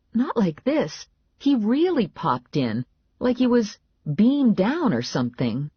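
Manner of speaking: urgent explaining to a doubter; exasperated insistence. A narrator's reading. Heroine argues to be believed.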